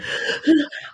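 a breathy gasp of joy; delighted gasp. Retold or imitated. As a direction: gasp in happy disbelief. Delighted at approval.

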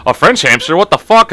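loud, rising incredulous outburst; mock disbelief. Officials hearing of the French hamster, mock incredulous. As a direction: echo the news as if it were absurd. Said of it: "and they're like".

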